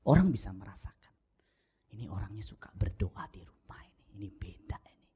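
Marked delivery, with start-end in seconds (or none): 1.9-5.1 hushed, confiding whisper; soft secret aside. Real, not acted. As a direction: lean in and whisper it. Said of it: "whispered aside".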